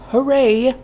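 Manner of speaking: ordinary delivery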